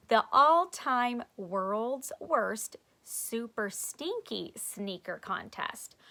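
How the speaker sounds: slow, deliberate reread; corrected slow reading. Shown as a demonstration. Reader correcting her flyer reading, slow. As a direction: read it again carefully. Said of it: "Miss Full read that wrong".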